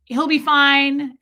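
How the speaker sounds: ordinary delivery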